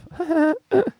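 high burst of laughter; signature laugh. Real, not acted. Host showing off his radio-show laugh, high burst. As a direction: let the trademark laugh rip, high. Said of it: "The laugh".

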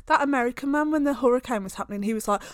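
normal talking voice

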